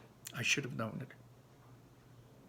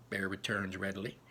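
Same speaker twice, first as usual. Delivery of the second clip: ordinary delivery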